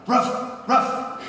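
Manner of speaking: words barked like a dog; high woofed answer, two short barks; talking dog. Acted. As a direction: bark the word out as a dog. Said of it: "And Rover says".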